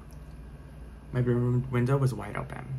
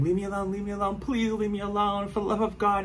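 frightened high plea; retold terrified pleading; 9 semitones higher than usual. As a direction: relive the panic of begging him to go. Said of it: "I'm screaming".

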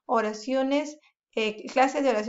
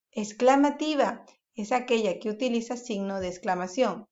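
ordinary talk, nothing marked

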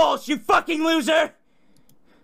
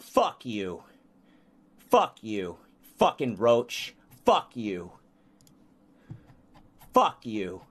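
much lower, curt, bitter curses; low seething anger; 3 dB quieter than usual. Real, not acted. Streamer answering insults from chat, low seething anger. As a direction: drop the voice right down and curse.

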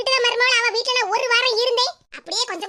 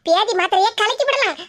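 ordinary talk, nothing marked

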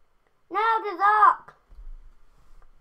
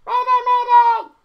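higher, loud pilot distress call; loud mock mayday; 4 semitones higher, 10 dB louder than usual. Gamer playing a crashing pilot as his plane falls, loud.

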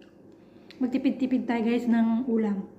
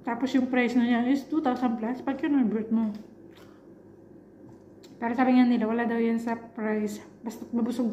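speaking between bites; talking while chewing; 3 dB quieter than usual. Real, not acted. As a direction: mouth partly full of food. Seen 3: Holds fish; took bite at -1; cheeks and lips show chewing while speaking.

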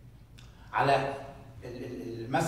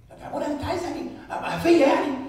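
mock congregant; 12 semitones higher, 7 dB louder than usual. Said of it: "voicing a congregant's objection".

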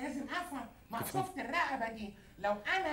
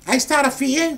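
very loud, confrontational question; angry challenge; 16 dB louder than usual. Real, not acted. Answering a bewildered man's question, angry. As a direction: loom over them with a loud challenge.

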